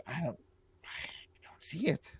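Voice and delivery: unvoiced whisper, slow. Real, not acted. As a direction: breathe it while searching. Searching the store listing.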